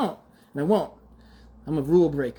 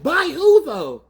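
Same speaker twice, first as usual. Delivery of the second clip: incredulous; 10 semitones higher, 6 dB louder, slower than usual. Real, not acted. Mocking the face-deletion idea.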